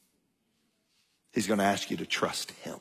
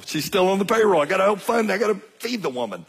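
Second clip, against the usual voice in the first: higher, louder repeat of payroll; exasperated repeat; 8 semitones higher, 3 dB louder, faster than usual. Retold or imitated. Telling his wife Ann about daughter's pay, exasperated. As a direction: hammer the point home, half joking. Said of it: "I remember telling Ann".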